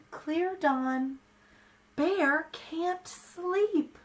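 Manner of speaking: put-on lively read-aloud for small children; story reading. A narrator's reading. Reads picture book to children.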